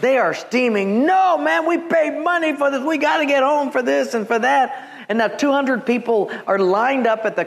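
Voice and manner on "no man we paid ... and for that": hammed-up angry traveler; mock livid crowd. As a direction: play the fed-up passengers lightly. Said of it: "just livid mad".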